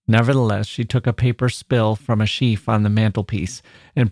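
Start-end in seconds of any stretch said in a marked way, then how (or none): none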